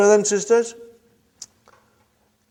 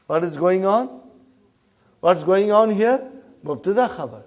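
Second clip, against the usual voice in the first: ordinary talk, nothing marked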